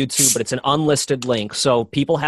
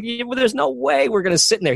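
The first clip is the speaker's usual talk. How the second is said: higher-pitched retold protest; incredulous refusal; 10 semitones higher than usual. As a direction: act out balking at an absurd idea. Retold refusal to enter filthy cabin.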